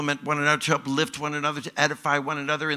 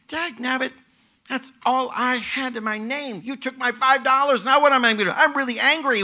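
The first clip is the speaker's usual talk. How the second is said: high-pitched, acted indignation; mock anger; 10 semitones higher, 5 dB louder than usual. Robbed person in the preacher's parable, acted anger. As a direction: protest the theft in a raised, hammed-up voice. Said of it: "wouldn't you be angry?".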